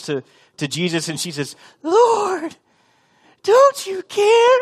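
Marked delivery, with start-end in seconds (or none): 1.8-4.6 very high, loud, slow falsetto plea; woman in distress voice. Acted. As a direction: squeal it like a damsel in distress. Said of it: "my best woman in distress voice".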